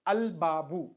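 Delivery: "al babu" with the l sounded before b; lam pronounced. Shown as a demonstration. Teacher shows learners a moon letter word, "al babu" with l sounded. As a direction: keep a clear l in "al babu". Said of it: "we are pronouncing the definite article".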